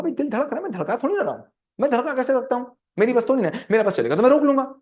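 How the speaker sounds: high-pitched helpless protest; acted incredulous self-defence; exasperated protest. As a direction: plead that it's out of your hands. Acted protest about involuntary heartbeat.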